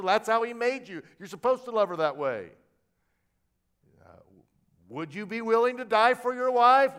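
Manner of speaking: full-force preaching. Acted. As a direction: drive the point home to the men.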